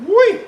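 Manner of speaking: high, loud gleeful whee; sudden high whoop of joy; excited squeal. Real, not acted. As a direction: squeal with delight as the food hits the pan. Excited squeal while frying.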